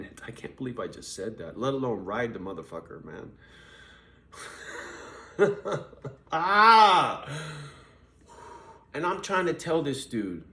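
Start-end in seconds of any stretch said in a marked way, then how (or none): 4.3-6.3 airy laugh at himself; breathless laugh. Real, not acted. Laughs at own purchase. 6.3-7.3 loud high "Ah!" of disbelief; loud exclamation. Real, not acted. Exclaims after laughing.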